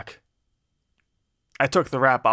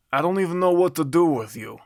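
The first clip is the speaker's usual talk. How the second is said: higher-pitched attempt at an Italian accent, or New York; bad Italian accent; 5 semitones higher than usual. A narrator's reading. Gangster heard shouting behind a door, bad Italian accent. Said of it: "trying to do a bad Italian accent".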